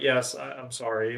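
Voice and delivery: low, soft retold apology; apologetic clerk. Store clerk apologizing for a pricey charger, sheepish. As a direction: sink into a quiet, resigned sorry.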